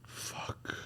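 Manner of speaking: whispered curse; barely voiced, under the breath; muttered to self. Real, not acted. Guest stumped by the film question, whispering to himself.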